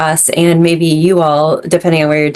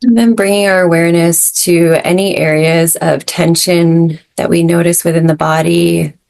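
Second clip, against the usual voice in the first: guided meditation. Acted. Leads breathwork meditation.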